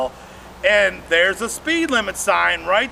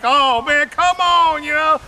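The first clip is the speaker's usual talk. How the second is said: pleading; 5 semitones higher than usual. Retold or imitated.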